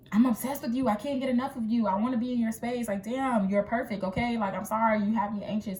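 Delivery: high, loud, gushing confession; frantic obsessed lover. Pretend. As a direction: blurt out the obsession all at once. Obsessed partner pleading intensely.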